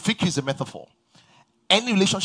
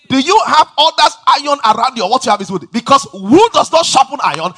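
louder, higher preacher's call to the congregation; preaching at full force; 7 semitones higher, 9 dB louder than usual. Acted. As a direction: preach it out, building fire.

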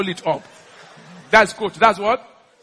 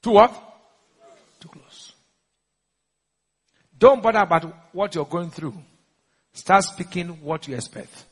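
ordinary delivery

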